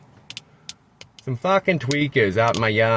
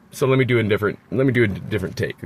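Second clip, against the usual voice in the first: usual speaking voice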